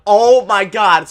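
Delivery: loud, slow, drawn-out cry; excited exclamation. Retold or imitated. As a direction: draw it out loud. Retold excitement at story.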